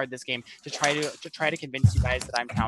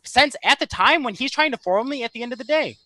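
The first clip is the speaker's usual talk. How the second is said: loud frustrated; 7 semitones higher, 10 dB louder than usual. Real, not acted. Frustrated defence in argument.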